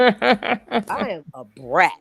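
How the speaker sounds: drawn-out bratty boast; playful brat. Real, not acted. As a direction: stretch it out slowly, playfully. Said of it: "I'm a spoiled, rotten brat".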